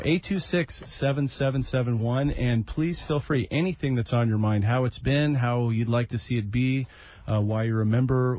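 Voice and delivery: normal talking voice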